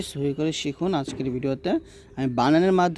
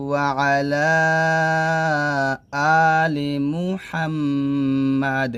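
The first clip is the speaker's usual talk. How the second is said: drawn-out chanted Arabic; measured, sustained teaching recitation; slow recitation; 4 dB louder, much slower than usual. Acted. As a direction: chant slowly, humming the doubled m.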